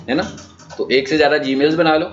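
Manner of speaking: ordinary talk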